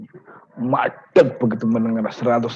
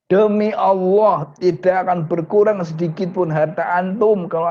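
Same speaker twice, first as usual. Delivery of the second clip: a preacher's solemn raised vow; emphatic oath; 6 semitones higher, 6 dB louder than usual. Acted.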